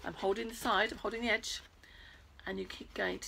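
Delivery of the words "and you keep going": soft unvoiced whisper. Real, not acted. Whispering encouragement to the children. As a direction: whisper it as an aside.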